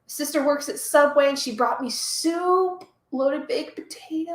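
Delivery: normal talking voice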